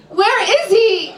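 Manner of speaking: high, slow, insistent question; demanding client. Acted. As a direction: press her for an answer.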